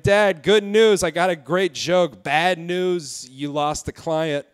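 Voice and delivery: hammed-up son breaking good and bad news; telling dad. Comic tells his gynecologist dad the news, slow and loud.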